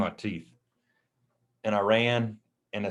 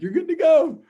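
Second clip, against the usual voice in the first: squeaky high, louder mock voice; playful high-pitched impression of dad's look; 9 dB louder than usual. Dad's look retold as words.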